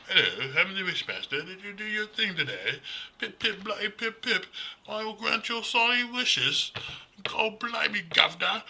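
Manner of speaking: high, loud, hammed-up British toff voice; mock British accent, pip-pip silly and high. Mocking the British actor who played the Wishmaster, high hammed-up British accent.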